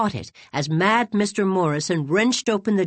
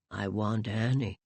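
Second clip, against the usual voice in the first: much lower and softer, barely voiced; mumbled; 9 semitones lower, 9 dB quieter than usual. A narrator's reading. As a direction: mumble it like a lost old man. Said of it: "he mumbled".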